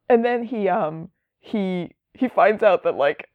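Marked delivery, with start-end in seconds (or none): none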